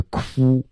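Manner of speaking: 哭 said with lips tightly rounded into an oo; 哭 as a puckered "koo"; pursed-lip onset. Shown as a demonstration. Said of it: "你要先嘟嘴发一个哭".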